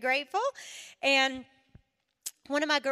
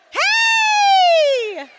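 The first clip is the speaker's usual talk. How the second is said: Hey! shouted much higher and louder; big Southern hey; 16 dB louder than usual. Shown as a demonstration. Speaker showing ladies how to greet her in heaven, shouting Hey!. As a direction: holler Hey! across heaven. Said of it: "Don't say hi".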